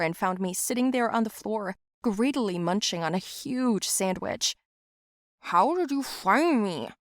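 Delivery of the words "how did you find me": slow, talking through a mouthful; mouth full. Acted. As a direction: ask around a big bite. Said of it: "I asked with my mouth full".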